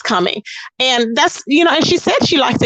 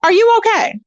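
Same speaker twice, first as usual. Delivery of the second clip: mock concern; 8 semitones higher than usual. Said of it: "I'm like".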